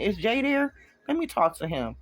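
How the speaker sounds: sharp, high snap on the question; snapping. Acted. Ex snaps a demand at the girlfriend, sharp and high. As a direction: bite out the demand.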